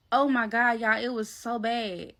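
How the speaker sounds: usual speaking voice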